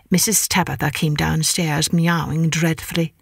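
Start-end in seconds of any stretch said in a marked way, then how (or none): none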